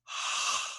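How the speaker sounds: long breathy exhale through the nose; ujjayi breath demo. Shown as a demonstration. Showing the yoga class how it sounds. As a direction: fog up a window but through the nose. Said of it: "it sounds like this".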